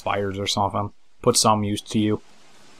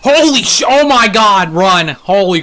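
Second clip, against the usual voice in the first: much higher, much louder panicked yelling; frightened scream; 9 semitones higher, 10 dB louder, slower than usual. Real, not acted. Player startled by a giant, screaming at friends to run. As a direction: shriek in real fright. Giant suddenly appears in game.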